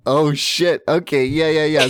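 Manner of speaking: ordinary talk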